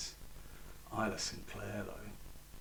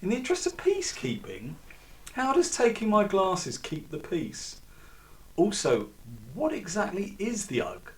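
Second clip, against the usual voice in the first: higher, incredulous protest; Alex pushes back at the agent, mildly indignant and higher; 6 semitones higher, 6 dB louder than usual. Acted. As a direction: push back in disbelief.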